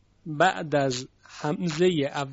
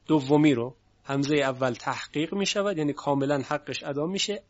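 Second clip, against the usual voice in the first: normal talking voice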